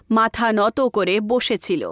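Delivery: plain reading voice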